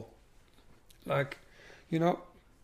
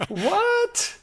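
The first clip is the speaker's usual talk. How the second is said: a shrieked high what, louder and far up; shocked what; 8 dB louder than usual. Retold or imitated. Wife reacting to his score of one, loud high shocked shriek. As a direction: play the wife's stunned outcry at top pitch.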